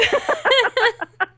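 real laugh at own impatience; high laugh. Real, not acted. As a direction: laugh it off at herself. Laughs about her impatience.

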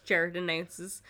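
usual speaking voice

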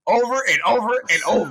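very high, loud outburst; exasperated repetition. Retold or imitated. Co-host mocking how often he said it, exasperated yell. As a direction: hammer the repeats in a high yell.

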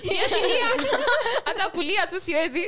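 high, loud laughing complaint; cracking up. Real, not acted. Laughing about no place.

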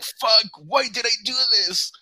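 high, loud, drawn-out lament; anguished mock wail. Retelling a painful roll down the stairs, mock wailing. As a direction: wail it slowly in exaggerated regret. Acting out regret while rolling.

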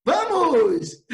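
put-on loud, drawn-out little-kid cheer; toddler's eager intonation. Grandfather imitating his two-year-old granddaughter, eager toddler call. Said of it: "É a entonação dela".

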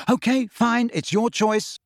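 high, exasperated concession; curt. A narrator's reading. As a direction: give in curtly. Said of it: "he said curtly".

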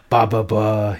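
loud ba ba ba like horns; roaring horn sounds. A narrator's reading. Drunken emperor roaring horn noises at his party, loud. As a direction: blare like a drunken brass band. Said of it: "he roars".

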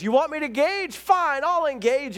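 high, loud hammed-up fury; exasperated cartoon dad blowing up; mock angry outburst. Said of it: "he comes storming out".

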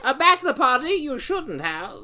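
put-on high old crone voice, laughing; laughing high witch voice.

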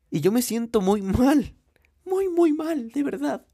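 high, loud, whiny mock crying; exaggerated fake sadness, pitch cracking upward; mock self-pity. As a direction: whimper it like you're about to cry, hammed up. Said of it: "Estoy casi llorando".

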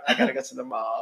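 a light mock of a bored rich teen. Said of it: "everybody's talks like this".

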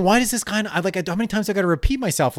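high-pitched retold exasperation; fed up at having to repeat it; exasperated. Retold exasperation at not being understood.

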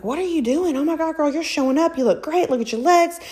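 high-pitched mock compliments; imitating gushing admirers. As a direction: gush like an amazed girlfriend. Admiring people voiced in her story.

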